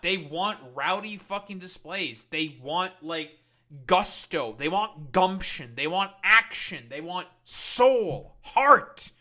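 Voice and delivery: building loud rant. Real, not acted. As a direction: hammer each word harder. Ranting list of wants.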